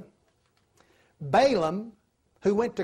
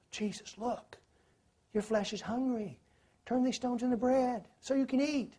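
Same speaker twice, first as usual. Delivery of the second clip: hushed, much softer devil voice, sly and close; sly devil whisper; 5 semitones higher, 6 dB quieter than usual. Acted. The devil slyly tempting hungry Jesus, in a hushed, sly whisper. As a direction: breathe the temptation softly into his ear.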